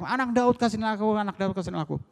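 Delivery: high-pitched insistent begging cry; shouted plea. Acted. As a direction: keep crying out for mercy, high and urgent. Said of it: "dia makin keras berteriak".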